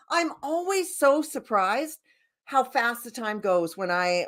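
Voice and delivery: ordinary talk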